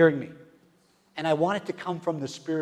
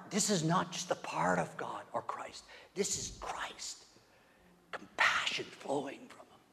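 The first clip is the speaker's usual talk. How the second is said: hushed, slowing whisper; awed whisper; 2 semitones higher, 4 dB quieter, slower than usual. Real, not acted. Preacher marvelling at Christ to the church, reverent whisper. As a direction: speak it in reverent awe.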